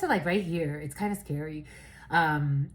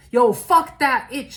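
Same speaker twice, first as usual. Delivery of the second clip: mock loud friend; 4 semitones higher, 9 dB louder, slower than usual. Friends mocking someone.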